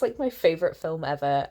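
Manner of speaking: ordinary talk, nothing marked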